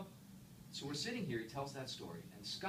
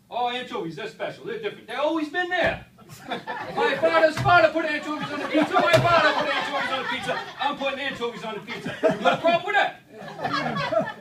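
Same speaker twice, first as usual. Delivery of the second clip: higher, very loud defiant shout with laughter; Vinny's rant; 18 dB louder than usual. Retold or imitated. Vinny defending anchovies to the speaker, loud laughing bluster. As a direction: challenge him, laughing and loud. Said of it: "You imagine the rant".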